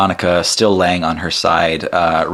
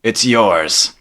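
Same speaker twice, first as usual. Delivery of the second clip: genie voice; 5 semitones higher, 3 dB louder, much slower than usual. Acted. Genie grants a wish.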